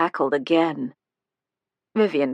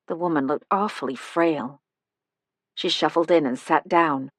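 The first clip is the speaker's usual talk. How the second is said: plain reading voice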